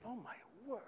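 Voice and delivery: high, very soft dismay; hushed shock. Retold or imitated. Hearing of a driver's dead fiancée, hushed shock. Reacting to news of fiancée's death.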